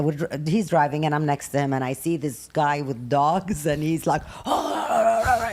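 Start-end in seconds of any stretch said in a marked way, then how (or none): 4.5-5.5 a mild mock yell; retold yell. Guy with dogs yelling at the driver, mild mock yell. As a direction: toss off a short angry cry. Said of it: "he's cussing and screaming".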